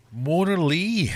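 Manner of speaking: high, loud, slow drawn-out name call; announcer. Acted. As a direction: announce the winner grandly.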